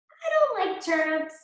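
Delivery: high little pig; the pig brushing off the wolf. A narrator's reading. Pig refusing the wolf's turnips, high.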